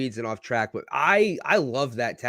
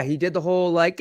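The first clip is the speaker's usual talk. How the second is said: ordinary delivery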